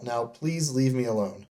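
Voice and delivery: ordinary talk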